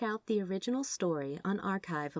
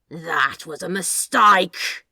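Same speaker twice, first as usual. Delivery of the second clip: slow, much louder serpent hiss; hissing snake bluff; 14 dB louder, much slower than usual. Acted. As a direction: bluff like a cornered snake rearing up. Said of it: "he bluffed, the words crackling into his hiss".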